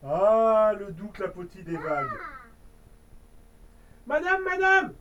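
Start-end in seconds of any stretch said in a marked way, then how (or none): 4.1-4.9 little hedgehog calling. Acted. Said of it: "lui demande un petit hérisson".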